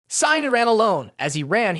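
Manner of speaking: ordinary delivery, nothing marked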